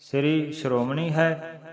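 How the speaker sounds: ordinary talk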